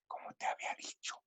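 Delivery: breathy secret whisper, voice gone; confidential whisper. Shown as a demonstration. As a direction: whisper "como te había dicho" like a secret. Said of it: "bajar el volumen para algo confidencial".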